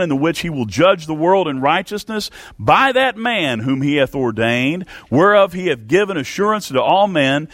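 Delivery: high, ringing preacher cadence; fervent preaching. Acted. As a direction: proclaim the scripture to the congregation. Preacher reads Acts at full force.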